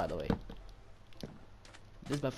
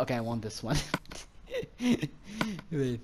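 normal talking voice

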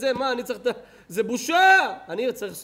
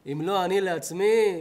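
usual speaking voice

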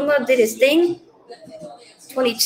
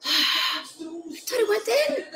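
softer and slow, near tears; deflated sigh; 4 semitones higher, 8 dB quieter, much slower than usual. Real, not acted. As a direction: sigh it out slowly, heartbroken. Penalty nearly went in.

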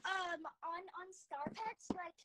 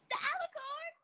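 high gasping disbelief; shocked gasp; 11 semitones higher, 6 dB louder than usual. Real, not acted. Gasps at an alicorn offer.

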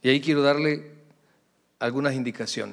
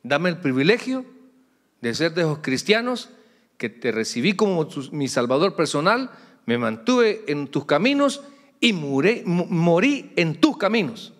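earnest raised prayer to God; fervent prayer; 4 semitones higher, 4 dB louder than usual. Retold or imitated. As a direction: pray for a faithful life, lifting the voice. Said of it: "una que le pido".

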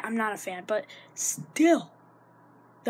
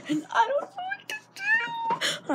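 much higher mock sob; fake crying whine. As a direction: ham up a whiny cry. Fake whine about gecko.

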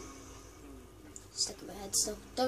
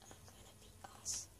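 whisper; 23 dB quieter than usual. Real, not acted. Whispered aside.

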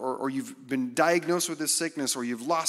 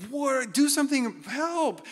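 high-pitched frantic cry for help; high panicked disciple begging for help; panicked plea; 6 dB louder, slower than usual. Retold or imitated. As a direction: yelp it like a scared disciple in a storm. Disciples panicking, wake Jesus.

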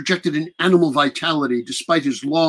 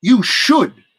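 louder, higher defiant retort; 6 semitones higher, 5 dB louder than usual. Acted. Prisoner warning Dracula about her poet, defiant. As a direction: snap back with confidence. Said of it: "she said".

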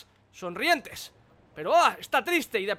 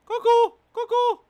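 very high, loud sing-song cuckoo; high taunting cuckoo; 10 semitones higher, 6 dB louder, slower than usual. Real, not acted. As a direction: tease them from up high. Taunting from above.

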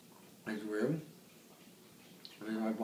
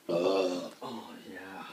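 usual speaking voice

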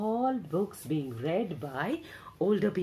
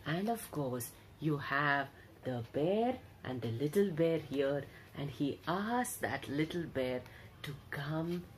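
hushed storytelling; 5 semitones lower, 8 dB quieter than usual. Acted. Narrator hushing young listeners over the picture book, much softer. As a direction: draw the children in with a hush.

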